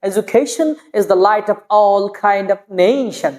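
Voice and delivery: slow, with "education" as e-ju-KHEI-shon; beautiful pronunciation. Shown as a demonstration. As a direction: stretch the ei in "education" and "nation". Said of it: "কত সুন্দর লাগছে শুনতে".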